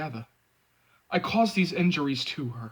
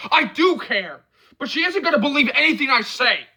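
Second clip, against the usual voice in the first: desperate; 9 semitones higher, 4 dB louder than usual. A narrator's reading. Desperate in fight.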